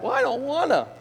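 put-on high little-kid whine; childish whine. Sinner refusing God like a child, high and whiny. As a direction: pout it like a child.

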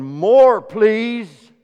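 high and loud little voice; loud plea. Pretend. Seeds shooting up begging the farmer, loud high plea.

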